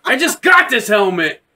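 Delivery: wail, then complain petulantly; comic villain lamenting damage; mock dismayed whining. Voicing Vader whining about broken helmet.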